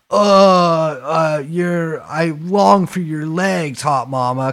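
slow, drawn-out caveman come-on; mock Neanderthal wooing, half-speed; Neanderthal love letter. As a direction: drag the words out like a lovesick caveman. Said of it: "sort of, like, Neanderthalic".

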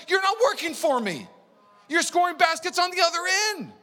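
high, loud, angry rebuke; furious shouting. Retold or imitated. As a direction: shout them down like a coach. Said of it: "he's like".

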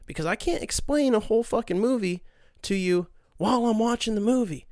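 usual speaking voice

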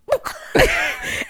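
high burst of laughter; re-enacted helpless laugh. Patient's mother cracking up at stranger's cancer news, helpless laugh. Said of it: "I don't know why I'm laughing".

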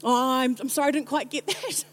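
mildly puzzled, apologetic asking to repeat; polite incomprehension. Retold or imitated. Heaven can't understand the words, mock polite confusion. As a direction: rise into a baffled, laughing apology. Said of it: "And so heaven's like".

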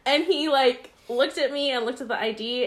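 ordinary talk, nothing marked

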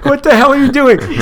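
very high, loud mock outrage; outraged superfan. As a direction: wail in hammed-up fan despair. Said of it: "He's like".

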